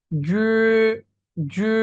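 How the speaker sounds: জু drawn out through a narrowed mouth; nearly closed mouth. Shown as a demonstration. Teacher showing the hardest sounds, mouth slightly closed. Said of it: "মুখটাকে বন্ধ করে একটু".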